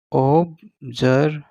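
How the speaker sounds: "observant" very slowly split as ob, zer, vent; syllable by syllable. Shown as a demonstration. Teacher writes it out for students, sounding "observant" in syllables. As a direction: break "observant" into three separate beats, voiced z. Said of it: "मैं लिख देता हूँ".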